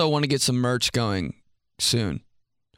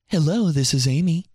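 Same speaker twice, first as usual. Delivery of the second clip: proper phone voice; 3 semitones higher, 4 dB louder than usual. Retold or imitated. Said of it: "Completely different voice".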